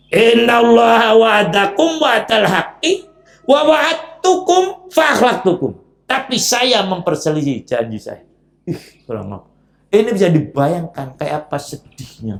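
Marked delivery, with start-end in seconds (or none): 0.1-8.2 Iblis declaiming. Acted. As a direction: proclaim it like a triumphant orator. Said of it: "Pidatonya panjang lagi".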